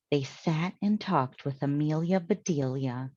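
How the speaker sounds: usual speaking voice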